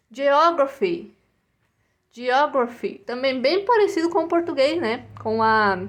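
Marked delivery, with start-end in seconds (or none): none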